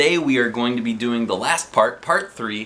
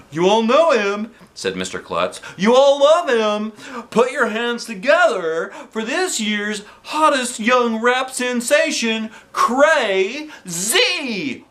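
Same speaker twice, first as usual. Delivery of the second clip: hype announcer; 9 semitones higher, slower than usual. Acted. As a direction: whip up the crowd. Principal introducing rap star.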